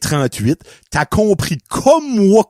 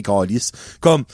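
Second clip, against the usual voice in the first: ordinary delivery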